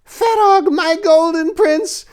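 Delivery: very high, slow ecstatic shriek; witch yell. Acted. Witch calling her devil prince, shrieking. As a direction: call out to her devil lover. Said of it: "Lucia yelled as she burned".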